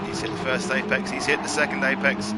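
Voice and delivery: rising excitement over two short calls; excited commentary. Real, not acted. Commentator calling a winner's last corners, building excitement. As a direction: call the corners as the car nails them. Live race finish commentary.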